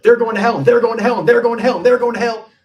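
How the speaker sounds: hammed-up Southern accent, finger-pointing tone; mock Southern preacher. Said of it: "the person was Southern in my mind".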